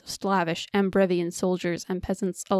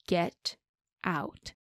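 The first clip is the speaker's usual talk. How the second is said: cold and dangerous; 5 dB quieter than usual. Acted. Woman coldly ordering the man out after slapping him. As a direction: say it quietly, as a final warning. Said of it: "her tone was cold, dangerous".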